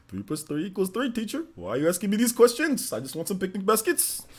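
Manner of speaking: sing-song cartoon bear, rising phrase ends; Yogi Bear. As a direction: pester the teacher as a cartoon bear. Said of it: "I kept on saying".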